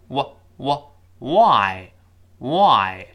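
slow why built up from a w; rounded w sound. Shown as a demonstration. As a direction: start why from rounded lips, drawn out. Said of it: "双唇碰撞之后又". (why先发双唇w音，慢读)

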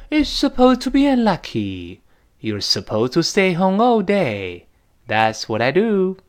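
dialogue reading. Shown as a demonstration.

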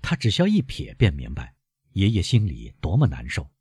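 ordinary delivery

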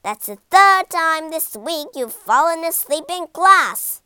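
loud, high teacher's yell; furious shout at a dozing schoolboy; angry yell. Acted. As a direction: yell at a sleeping pupil. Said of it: "yelled his teacher".

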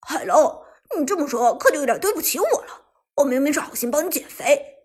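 loud, mock-wronged protest; brash wheedling at full voice; shameless rascal. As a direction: argue brazenly as if you were the injured one. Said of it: "摆出一副破皮无赖的样子".

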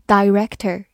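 "director" with first syllable like "die"; alternative pronunciation. Shown as a demonstration. Teacher showing the other accepted reading, long-I "director". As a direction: say "director" as dye-REK-ter, long I. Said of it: "字母I也可以发它本身的音".

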